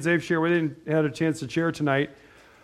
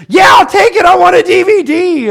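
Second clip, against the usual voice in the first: put-on high, loud, thrilled little-girl voice; excited little girl; 13 semitones higher, 13 dB louder than usual. Little girl accepting money for a DVD, high excited shout. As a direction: squeal it like a thrilled child grabbing a treat. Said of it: "she was all excited".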